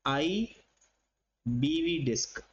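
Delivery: आईबीवी disc said very slowly, letter by letter; slow mnemonic. Shown as a demonstration. Teacher giving students a memory trick, very slow. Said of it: "ये इसके अंदर सारे cover हो जाएंगे".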